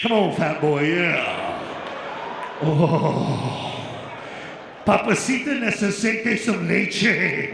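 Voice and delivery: put-on low, loud, seductive Latin-accented taunt; tempting Oreo cookies.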